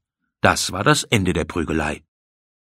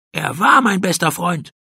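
high, defiant cry; indignant exclamation; 10 semitones higher than usual. A narrator's reading. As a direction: call out the correction crossly. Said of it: "rief Pauli".

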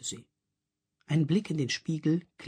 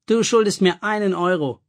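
louder teasing call from the balcony; 5 semitones higher, 6 dB louder than usual. A narrator's reading. Clara calls down to Fifi from the railing. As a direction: call across the room, teasing. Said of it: "rief Clara Fifi vom Geländer".

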